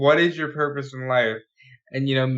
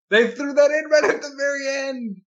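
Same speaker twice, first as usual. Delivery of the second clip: excited shout; 11 semitones higher than usual. Real, not acted. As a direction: shout it out in delight. Amazed at the credit song.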